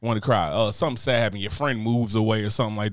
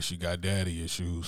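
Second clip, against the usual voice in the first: mocking quote; 6 semitones lower, 8 dB quieter, slower than usual. Mocking what men say.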